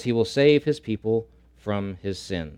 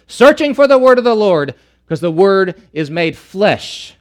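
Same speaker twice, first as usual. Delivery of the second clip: full-force preaching burst, higher and louder; preaching climax; 7 semitones higher, 9 dB louder than usual. Real, not acted.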